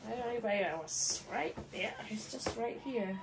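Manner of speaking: ordinary delivery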